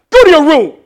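loud, high stern dad command; sudden stern parental shout; serious parent. Acted. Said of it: "when you serious".

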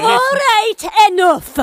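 loud, sharp order to stop; stern command. Acted. Captain sternly stops the crew's panic. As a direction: cut them off firmly.

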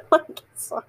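real giggles breaking into the words; can't stop laughing while talking; laughing hard. Real, not acted. Laughing at their own reply to an I love you, laughing hard. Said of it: "why I'm laughing so hard".

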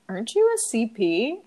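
usual speaking voice

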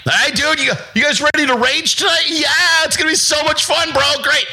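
high excited party-bro voice; high hyped party bro; mock bro.

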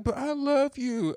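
high, drawn-out lovesick plea; imitated pleading girlfriend. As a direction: mimic a girlfriend begging, slow and high. Girlfriend pleading in retold quarrel.

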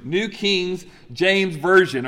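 slow, deliberate "New King James Version"; careful correction. Real, not acted. Preacher fixing his slip on "New King James Version", slow and deliberate.

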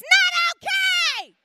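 very high, drawn-out shout; hammed-up little-girl scream; child's angry yell. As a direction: bellow it slowly at full force. Sister shouts back, contrasting sweet replies.

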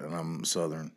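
ordinary delivery

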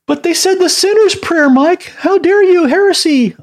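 very high, scandalised objector voice; hammed-up high-pitched indignation; mock outrage. Mimics an outraged objector crying heresy.

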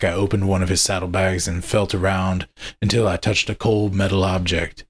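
plain reading voice

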